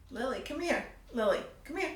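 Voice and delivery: high-pitched call to Lily, rising at the end; real summons with a lifted, rising voice; calling someone over. Real, not acted. Calling Lily over mid-story.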